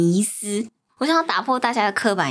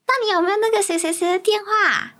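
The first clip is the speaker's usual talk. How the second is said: nosy relative voice; 9 semitones higher than usual. Retold or imitated. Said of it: "亲戚吧".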